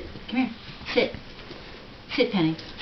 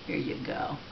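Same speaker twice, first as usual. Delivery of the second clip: ordinary delivery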